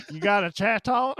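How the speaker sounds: playful put-on Alabama twang; mock an Alabama accent. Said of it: "imagining her actually with Alabama accent".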